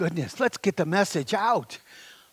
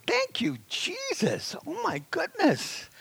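much higher, soft, overcome; grateful cry; 10 semitones higher, 4 dB quieter, slower than usual. Real, not acted. Preacher thanking Jesus, high and moved. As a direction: lift it up in thanks.

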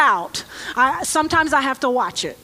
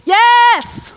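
emphatic yes; 6 semitones higher, 5 dB louder than usual. Acted. Calling out the only answer.

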